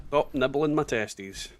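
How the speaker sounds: usual speaking voice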